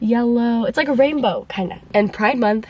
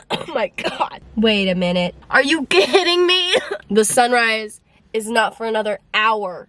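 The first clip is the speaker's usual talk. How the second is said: normal talking voice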